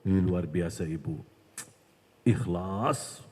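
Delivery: low, flattering devil voice; bisikan syaitan. Acted. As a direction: praise her in a deep tempter's tone. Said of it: "Ini bisikan syaitan loh ini". (setan memuji dengan suara rendah)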